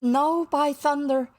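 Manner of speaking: high, loud, slow refusal; defiant cry. A narrator's reading. Starkey refuses his captain's order, defiant cry. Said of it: "Starkey cried".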